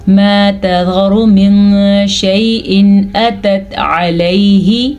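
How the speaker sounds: very slow recitation, hidden nasal n in "من"; measured Quranic recitation. Shown as a demonstration. Teacher reciting for learners, "من" slow and nasalised. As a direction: recite slowly, humming through "من شیء". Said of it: "اسے ہم کیسے چھپائیں گے".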